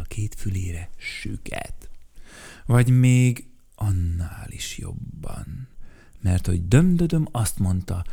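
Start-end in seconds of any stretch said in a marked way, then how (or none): none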